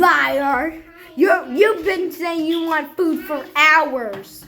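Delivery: loud, slow high scolding; playful scolding shout. Real, not acted. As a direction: call him out loudly. Scolding brother who said no.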